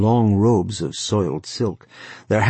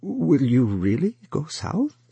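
higher, hesitant question; uncertain; 7 semitones higher than usual. A narrator's reading. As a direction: ask unsure of the answer. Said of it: "Wang Long's uncle said uncertainly".

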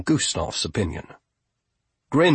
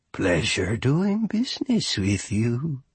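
put-on old goblin voice; slower than usual. A narrator's reading. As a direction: drawl it like a creepy old goblin.

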